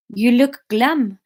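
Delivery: slow, put-on French accent; drawn-out French-accented greeting. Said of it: "we'll go French".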